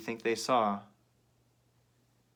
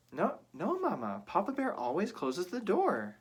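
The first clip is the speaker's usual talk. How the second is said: high little cub voice; small bear protesting, high; Baby Bear; 7 semitones higher than usual. Acted. Baby Bear answering Mama, high and childlike.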